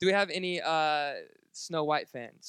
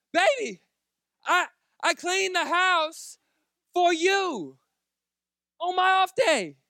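much higher, slow and loud; whiny pleading; 14 semitones higher, 10 dB louder, slower than usual. Retold or imitated. Husband whining to his laughing wife, high and slow. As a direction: whine like a hurt husband. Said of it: "finally, I'm like".

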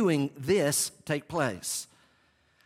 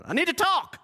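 high-pitched comic outburst; eager Peter interrupting; 3 dB louder than usual. Acted. Preacher mimics Peter butting in, high-pitched comic outburst. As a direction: blurt it out in a much higher voice. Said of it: "here comes Peter".

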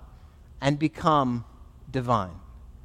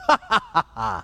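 staccato villain "ha ha ha"; put-on wicked laugh, higher than speech; evil-sounding laugh; 4 semitones higher, 3 dB louder than usual. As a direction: gloat with an acted evil cackle. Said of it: "an evil-sounding laugh like that was".

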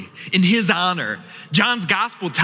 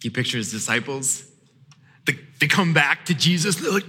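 ordinary talk, nothing marked

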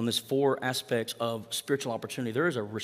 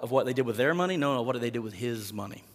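normal talking voice

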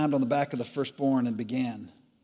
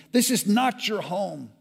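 angry demand; 8 semitones higher, 3 dB louder, slower than usual. Acted. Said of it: "they demanded".

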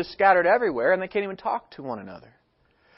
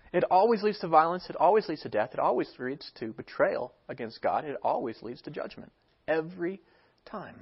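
repeated lines sinking lower and quieter; fading litany; 3 semitones lower, 4 dB quieter than usual. Real, not acted. Preacher's litany to the congregation, sinking to a hush. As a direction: let each one fall away to near silence.

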